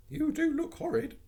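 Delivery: put-on a small child saying it straight out; blunt little girl. Jane blurting at the angry lady, childish and blunt.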